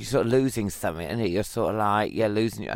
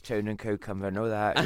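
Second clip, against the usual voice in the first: put-on Northampton accent; 3 semitones lower, 5 dB quieter than usual. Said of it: "how they talk in Northampton".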